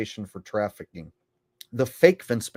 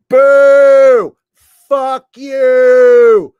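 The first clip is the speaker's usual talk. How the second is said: long, high yelled boos of a hostile arena; loud booing; 16 dB louder, much slower than usual. Retold or imitated. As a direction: imitate a jeering crowd at full volume. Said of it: "the loudest boos Hulk has ever got".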